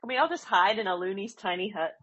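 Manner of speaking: normal talking voice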